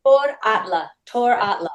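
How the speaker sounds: "Tor Atla" said clearly, Tor stressed, falling at the end; careful name pronunciation. Shown as a demonstration. Telling the host the teacher's name, clearly pronounced. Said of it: "What's the gentleman's name?".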